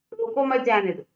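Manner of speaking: "रोको मत जाने दो" in one unbroken run; no pause. Shown as a demonstration. Teacher showing students the unclear sentence, no pause in "रोको मत जाने दो". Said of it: "ये कोई भी क्लियर".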